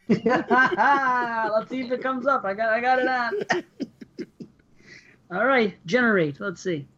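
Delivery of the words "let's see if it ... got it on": laughing. Real, not acted. Laughing while starting generator.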